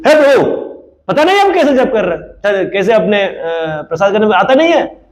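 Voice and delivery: high, hammed-up whiny devotee; mocking high sing-song grumble; mock pious complaint.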